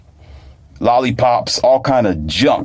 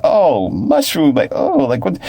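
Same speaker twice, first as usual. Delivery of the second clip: drawn-out, higher-pitched doubtful "oh"; imitated dubious child; 7 semitones higher, slower than usual. Child told it is mushroom, imitated doubt. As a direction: mimic a child unsure about the food.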